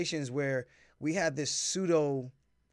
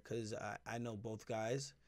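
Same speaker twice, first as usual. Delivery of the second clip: biting tongue; 3 semitones lower, 7 dB quieter than usual. Real, not acted. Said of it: "I gotta choose my words very carefully here".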